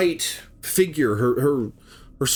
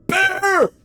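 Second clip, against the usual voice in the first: very high, strained desperate yell; 3 dB louder than usual. Retold or imitated. Boyfriend yells at bear on his girlfriend, frantic yelling. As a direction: scream at the animal to back off. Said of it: "And he yelled at it".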